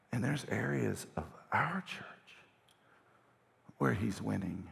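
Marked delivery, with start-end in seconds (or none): none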